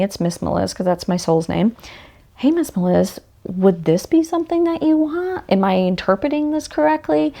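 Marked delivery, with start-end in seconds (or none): 2.4-7.3 asking her soul. Pretend.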